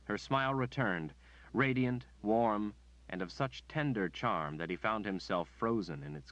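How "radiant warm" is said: plain reading voice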